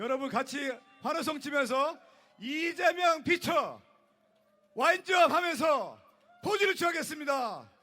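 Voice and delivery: loud, rising ballpark play-by-play; baseball caster. Acted. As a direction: call the pitch like a stadium announcer. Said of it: "그 야구 캐스터처럼 한번 하겠습니다". (야구 캐스터처럼 외치는 중계)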